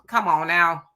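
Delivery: usual speaking voice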